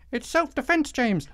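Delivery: high, sneering mock voice of a gun defender; high-pitched parody of a defensive critic. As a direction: squeak it out like a smug opponent. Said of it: "mocking gun owner's reply".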